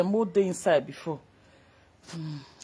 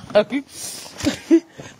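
laugh; 4 semitones higher than usual. Real, not acted.

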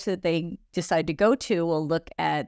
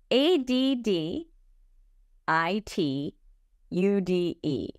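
slow letter-by-letter spelling; spelled out; slower than usual. Shown as a demonstration. Host spelling a magazine name for listeners, spelled out slowly. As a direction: spell it out slowly, pausing between groups. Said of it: "so everybody can hear".

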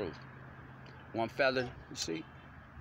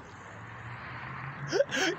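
high laugh. Real, not acted.

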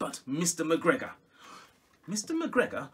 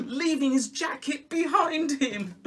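usual speaking voice

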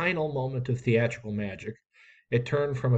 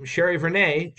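calling out again; 6 semitones higher, 4 dB louder than usual. A narrator's reading. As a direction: finish the call with the surname. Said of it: "she called".

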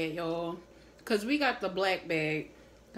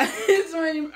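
laughing; 8 semitones higher, 8 dB louder, slower than usual. Real, not acted. Laughs at friend's struggle.

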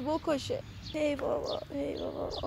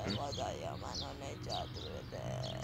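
much lower, much softer, slow plea; a mock-desperate whispered appeal; quiet prayer; 15 dB quieter, much slower than usual. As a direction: murmur the prayer to God. Girl repeats her prayer softly.

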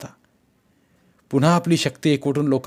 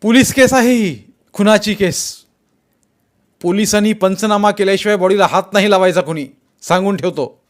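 loud, raised-pitch order to a crowd; shouted warning; 7 semitones higher, 7 dB louder than usual. A narrator's reading. Jaydeep warns the crowd off the body, shouting. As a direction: warn them all at the top of your voice.

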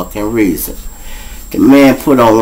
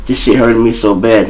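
normal talking voice

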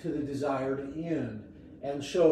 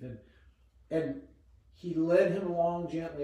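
ordinary delivery

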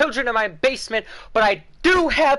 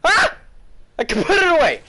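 high, loud yelp; frustrated outburst; 7 semitones higher, 4 dB louder, faster than usual. Real, not acted. Gamer struggling to put the sword away, frustrated outburst. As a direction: cry out at the stubborn game.

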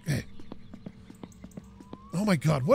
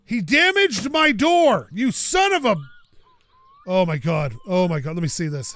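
ordinary delivery

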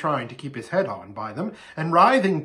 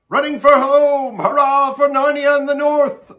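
high, loud hurrah; exultant full-voiced cry; cheering shout; 13 semitones higher, 11 dB louder than usual. A narrator's reading. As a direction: belt out a rallying cheer. Dwarf cheers the escape plan.

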